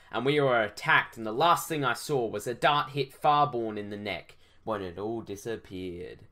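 usual speaking voice

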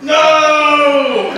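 high loud panicked no; alarmed no. Retold or imitated. Partner yelling as he reaches into the trap. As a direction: yell no as the arm goes. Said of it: "like, like".

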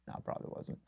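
breathy under-the-breath whisper; whispered afterthought; whispered aside. Real, not acted. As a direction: mutter it to yourself, barely audible. Muttered aside about retracted message.

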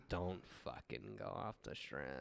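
very low, soft imitated warning; hushed warning. Locals warning tourists about the strip, hushed and low. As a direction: drop right down to caution a tourist.

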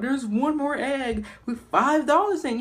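calling it out across the yard; auntie calling out. Retold or imitated. Auntie announcing the last egg to the kids, high and loud. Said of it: "one of my aunties yelled out".

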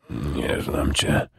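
weak, drowsy mumble, slowly; mumbled. A narrator's reading. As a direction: barely get the words out, mumbling. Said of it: "wymamrotał Harald".

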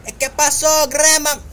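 high, loud mock-friendly hail; mock greeting. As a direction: tease the old lady with a cheeky hello. Mock greeting to game villager.

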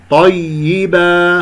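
slow طيبة with a continuous, voiced ي; audible ya. Shown as a demonstration. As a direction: let the doubled ي of طيبة ring through. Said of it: "هكذا أسمع صوت الياء". (إسماع صوت الياء في طيبة)

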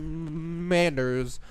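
"Commanders" stretched slowly on a repeated m; playful stutter. Pretend. Gamer toying with the word "Commanders", stretched stutter.

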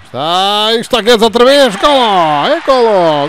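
goal shout. Real, not acted. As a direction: scream the goal. Goal call. (grito de golo)

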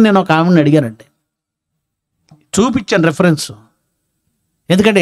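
ordinary talk, nothing marked

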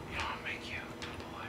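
creepy whisper. Retold or imitated. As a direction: breathe it like a secret. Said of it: "You go".

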